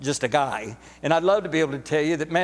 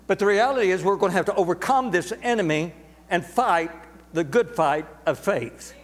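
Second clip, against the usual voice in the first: normal talking voice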